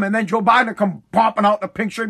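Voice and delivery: ordinary delivery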